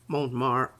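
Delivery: Montmartre in a posh French accent, nasal vowel, silent final e; posh French pronunciation. Shown as a demonstration. Artist naming her gesso brand in posh French. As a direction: say Montmartre like a Parisian, a bit grand. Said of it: "you make it sound so posh".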